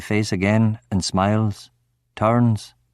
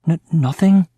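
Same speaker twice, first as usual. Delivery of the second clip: high, nervous stammer; 7 semitones higher, 4 dB louder than usual. A narrator's reading. Frightened boy answering the angry man, stammering. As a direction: stumble on the first sound, pitched up. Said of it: "I stammer".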